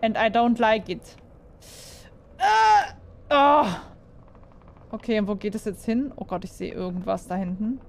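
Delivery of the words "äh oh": short high frightened cry; startled yelp. Real, not acted. Gamer startled by a monster, high frightened yelp. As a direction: jump at a sudden scare. Sudden scare in game.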